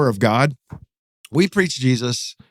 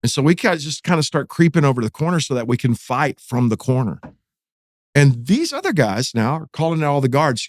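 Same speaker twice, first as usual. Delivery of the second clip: plain reading voice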